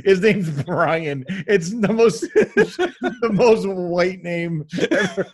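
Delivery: slow words broken by laughter; laughing hard mid-sentence. Real, not acted. Laughing about the name.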